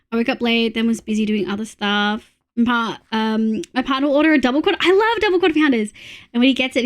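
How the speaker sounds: ordinary talk, nothing marked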